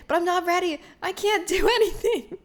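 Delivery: high whine breaking into laughter; laughing, whiny panic; comic despair. Real, not acted. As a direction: wail it in mock helplessness. Mock despair about dance class.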